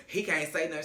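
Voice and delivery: usual speaking voice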